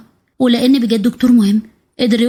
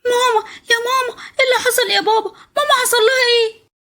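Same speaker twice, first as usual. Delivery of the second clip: put-on much higher child's voice, sobbing; a frightened little boy crying for mama; 13 semitones higher than usual. Child finds his bleeding mother.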